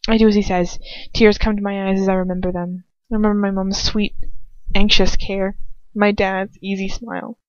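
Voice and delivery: usual speaking voice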